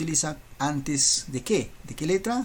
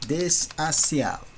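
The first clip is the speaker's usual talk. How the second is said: slow; slower than usual. Shown as a demonstration. Teacher drawing out the answer.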